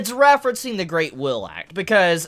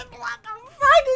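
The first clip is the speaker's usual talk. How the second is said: much higher, blurted disbelief; stunned disbelief; slower than usual. Real, not acted. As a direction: blurt it, laughing in shock. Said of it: "And I'm like".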